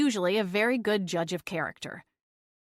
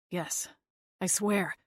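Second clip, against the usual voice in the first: much softer, cracking plea; voice breaking; 2 semitones lower, 6 dB quieter than usual. Acted. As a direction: let the voice crack on the promise. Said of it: "Clint's voice broke".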